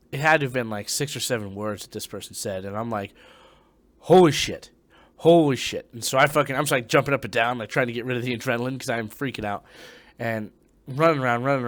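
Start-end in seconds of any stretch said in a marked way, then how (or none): 4.0-5.9 loud, punched exclamation of alarm; retold shock. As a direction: blurt it out loudly, reliving the fright. Retelling shock at voice in ear.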